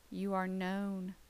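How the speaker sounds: plain reading voice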